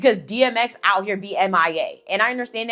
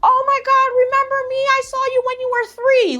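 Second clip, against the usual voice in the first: squealing very high, louder mock-excited auntie; gushing relative; 4 dB louder than usual. Relative who barely knows her gushing over the reunion, mock squeal. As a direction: gush like a long-lost relative who acts close. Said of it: "when people be on some".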